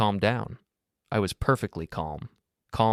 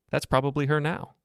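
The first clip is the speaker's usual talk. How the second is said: helpful; 7 semitones higher than usual. A narrator's reading. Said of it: "I said helpfully".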